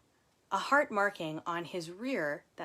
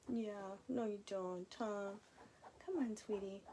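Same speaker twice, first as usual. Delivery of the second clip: soft coaxing; 3 semitones higher, 8 dB quieter than usual. Real, not acted.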